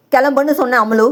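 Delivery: ordinary delivery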